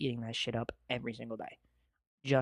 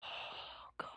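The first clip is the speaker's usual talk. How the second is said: awed whisper; 18 dB quieter than usual. Real, not acted. Overwhelmed after the song.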